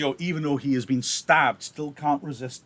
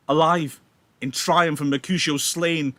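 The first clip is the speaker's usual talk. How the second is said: louder, furious vow; burn with revenge as you speak; fuming; 2 semitones higher, 6 dB louder than usual. Acted. Romeo fuming as Tybalt returns, loud furious vow. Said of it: "Romeo is fuming".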